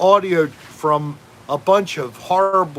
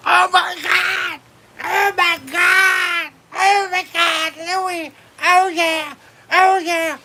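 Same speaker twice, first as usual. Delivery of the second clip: high, hammed-up porn moaning; mock moaning woman; 13 semitones higher, slower than usual. Host imitating neighbours through the wall, high hammed-up moans. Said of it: "Something like that".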